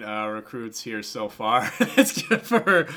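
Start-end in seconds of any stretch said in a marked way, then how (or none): 2.0-2.9 laughing. Real, not acted. Laughs at the low ranking.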